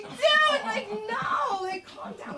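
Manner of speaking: high, loud burst easing off; hammed-up high-pitched exasperation; panicked protest. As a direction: cry out in comic alarm, then rein it in. Panicked reply to dramatic kid.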